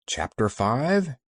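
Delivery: ordinary delivery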